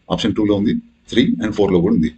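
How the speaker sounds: normal talking voice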